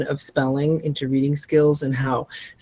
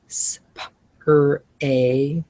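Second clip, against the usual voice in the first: normal talking voice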